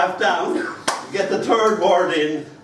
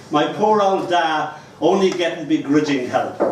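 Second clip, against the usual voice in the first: ordinary delivery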